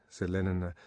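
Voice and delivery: ordinary delivery, nothing marked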